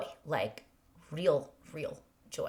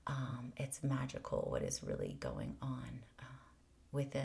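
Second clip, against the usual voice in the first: awed whisper; 4 semitones lower, 11 dB quieter than usual. Real, not acted. Hushed wonder.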